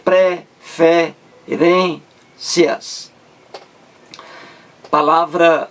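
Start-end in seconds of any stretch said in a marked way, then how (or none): 0.0-3.1 "Preferências" very slow, pausing between pre, fe, rên, cias; syllable by syllable. Shown as a demonstration. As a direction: chop "Preferências" into four separate syllables. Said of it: "penúltima sílaba". ("Preferências" silabado, bem devagar, com pausas)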